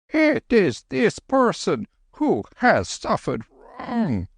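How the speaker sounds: high, slow calling; slow high worried old monk; anxious Lama. Acted. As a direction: call out gently in the dark. Lama, hand over brow.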